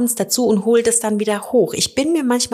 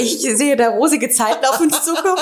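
laughing; 5 semitones higher than usual. Real, not acted. Joking about rosy times.

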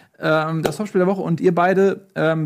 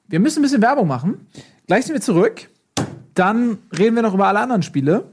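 announcer voice; 5 semitones higher than usual. Acted. Host announcing ad break.

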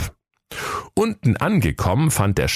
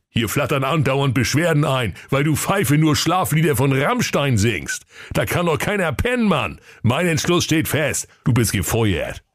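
higher, worked-up boss calling out; upset shout; 8 semitones higher than usual. Acted. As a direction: bark it out, fed up. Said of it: "ganz aufgebracht".

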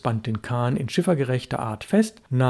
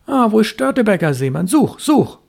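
put-on high, loud, rushed call for the boy; urgently calling the dog, fast; hasty call; 8 semitones higher, 6 dB louder than usual. Fisherman urging the dog to find his son, hasty loud call. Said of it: "rief er hastig".

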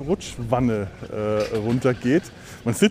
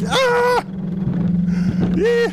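screams; 3 dB louder than usual. Real, not acted. Screams in a curve.

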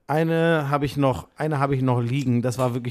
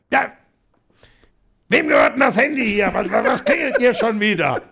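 higher, half-laughing mock shout; retold yell; 6 semitones higher, 3 dB louder than usual. Retelling how Buschi yelled in the hotel lobby, mock shout. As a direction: imitate a colleague yelling about the ringing phone. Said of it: "Und dann schreit Buschi".

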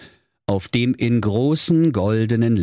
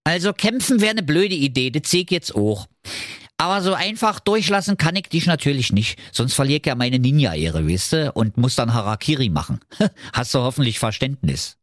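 put-on high, fast Berlin accent, apologetic with a laugh; Berlin ninja; 6 semitones higher, faster than usual. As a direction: explain your ninja honour chattily. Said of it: "sprach er".

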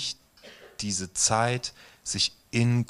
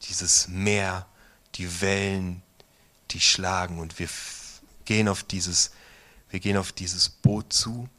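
guided meditation; 3 dB quieter than usual. Acted. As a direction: guide them gently into the storm scene. Leitet eine Meditation. (ruhige Meditationsstimme, langsam mit langen Pausen)

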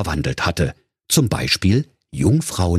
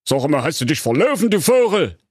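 higher, fast, sneering evil wizard voice; contemptuous wizard; 7 semitones higher than usual. A narrator's reading. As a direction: look down on the intruder and sneer. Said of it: "sah ihn verächtlich an und fragte".

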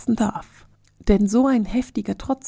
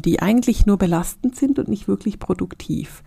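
ordinary delivery

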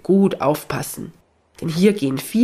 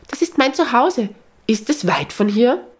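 much higher, thrilled cry of recognition; delighted call; 7 semitones higher than usual. A narrator's reading. Elf recognises her home in the bell, delighted and high. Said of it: "klatscht entzückt in die Hände und ruft".

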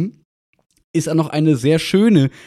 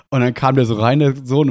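ordinary delivery

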